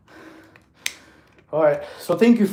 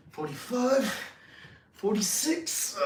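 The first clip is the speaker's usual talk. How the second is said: higher, strained rep count; straining effort; 6 semitones higher, 8 dB quieter, slower than usual. Real, not acted. Man counting his last handstand push-ups, straining. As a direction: push the last counts out, dragging the final one.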